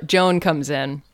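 ordinary delivery

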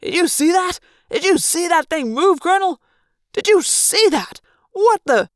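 a pilot who can't believe his eyes; stunned pilot. Acted. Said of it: "fainter, crackling voice over the radio".

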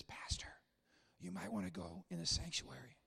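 whispered, discreet; whispered warning. Retold or imitated. Church member warning the pastor at a funeral, whispered. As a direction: lean in and whisper urgently. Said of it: "somebody comes to me and says".

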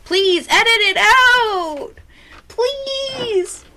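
very high, slow begging cry; high pleading whine. Real, not acted. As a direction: plead to have it cut, high-pitched. Begging friend to cut the yell.

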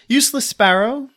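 ordinary talk

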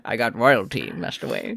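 low British butler voice; low British butler murmur; Michael Caine voice. Retold or imitated. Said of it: "Michael Caine voice".

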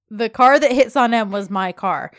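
loud mocking echo, falling at the end; sarcastic repeat. [name] repeats Yeet's own sentence back, sarcastic. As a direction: parrot it back loudly as a statement. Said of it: "[name] repeats back to him".

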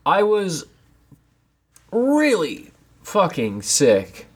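usual speaking voice

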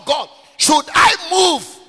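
slow, high, loud plea to God; pleading call to God. Acted. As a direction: cry out the question upward, slowly. David calling out to God.

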